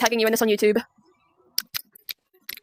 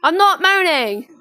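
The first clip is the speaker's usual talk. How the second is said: louder, higher and slow; indignant protest; 6 semitones higher, 5 dB louder, slower than usual. Real, not acted. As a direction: insist you are not complaining. Protesting an accusation.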